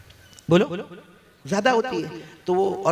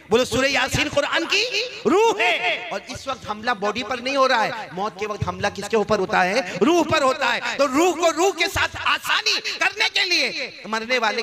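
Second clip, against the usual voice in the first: full-force preaching; 8 semitones higher than usual. Real, not acted. Preacher teaching congregation about Surah Yasin, impassioned. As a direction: press the point home to the crowd.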